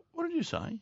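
high, pinched incredulous question; retelling his own shock; mock disbelief. Confronting the coach again.

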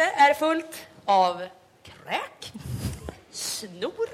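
very slow, dragging out each word; drawn out. Real, not acted. Recalling waking up, words drawn out slowly. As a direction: stretch it out in disgust. Disgusted recounting.

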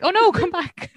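high, loud retold plea; playfully alarmed cry; mock dismay. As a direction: call out after someone, half-laughing. Calls back man who fell off.

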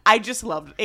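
normal talking voice

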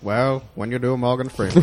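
low, drawling narrator impersonation; Morgan Freeman. Acted. Explaining how to do the impression, measured Morgan Freeman. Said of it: "hear Morgan Freeman explain to me".

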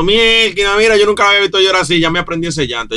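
exclamación aguda y fuerte de padre maravillado; contento, emocionado. Retold or imitated. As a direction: recrea tu entusiasmo, subiendo voz y tono. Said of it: "Yo estaba contento". (emocionado y contento)

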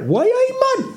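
high, hammy Geordie [name] voice; Newcastle accent. Retold or imitated. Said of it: "whenever we do an impression".

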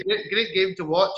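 ordinary delivery

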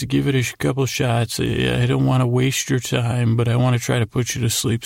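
usual speaking voice

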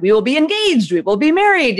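playfully retold stubborn declaration; willful insistence. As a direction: dig in stubbornly, imitating your past self. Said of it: "I'm a very willful person".

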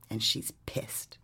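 slow eerie whisper; spooky whisper. Retold or imitated. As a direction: hush it like a ghost story. Spooky retold quote.

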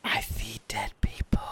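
eerie ghost-story whisper. Acted. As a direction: breathe it like a spooky secret. Spooky Sixth Sense quote spoof.

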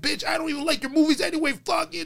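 hammed-up angry girl shouting back; mock yell. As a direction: yell it high like a girl losing it. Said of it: "one girl was like".

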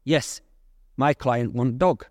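slow, imitated Russian middleman; Russian accent. Caller speaking for his client, in a Russian accent. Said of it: "strong Russian accent".

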